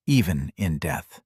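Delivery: ordinary delivery, nothing marked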